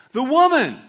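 a sudden loud cry of "The woman", pitched high; blaming shout. Acted. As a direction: point the finger, shouting. Adam blames Eve, quoted.

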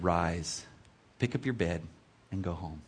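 low, soft, unhurried instruction; gentle command. Acted. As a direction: speak softly and low to the one being healed. Jesus tenderly healing the paralytic, acted.

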